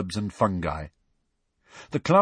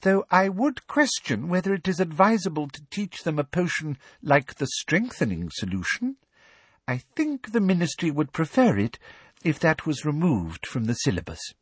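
prim official lady; 8 semitones higher than usual. Acted. Umbridge voiced in story.

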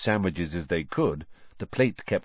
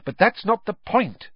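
impatient; 12 semitones higher than usual. A narrator's reading. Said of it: "said Hermione impatiently".

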